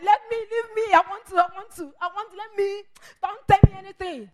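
high, loud, stammering protest; worked-up yelling, stumbling over repeats; angry outburst. Acted. Acting out venting anger.

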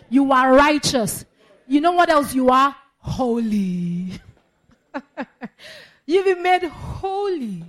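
loud, slow, hammering declaration; full-force preaching. Acted. Pastor proclaims to the congregation they are righteous, loud and slow.

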